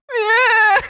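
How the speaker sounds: very high, loud sobbing yeah; mock sob. Answering her mom while crying, mock sob. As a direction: blubber the yeah. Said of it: "And I was like".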